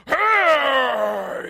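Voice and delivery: very loud, drawn-out hey; mock possessive yell. People stop God discussing money, mock possessive yell. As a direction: yell like a protesting child. Parallels the son's protest.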